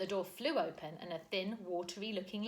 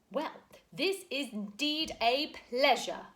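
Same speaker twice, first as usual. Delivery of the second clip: a big booming slow greeting; huge voice; 6 semitones higher, 8 dB louder, slower than usual. A narrator's reading. Mister Wig greeting the children, huge voice. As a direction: boom out a jolly welcome. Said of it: "a huge voice greeted them".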